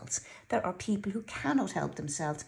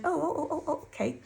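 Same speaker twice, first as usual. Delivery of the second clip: shocked stammer; 7 semitones higher, faster than usual. Retold or imitated. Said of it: "I was just sort of really shocked".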